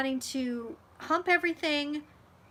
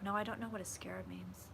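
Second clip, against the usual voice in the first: ordinary talk, nothing marked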